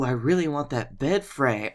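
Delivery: ordinary talk, nothing marked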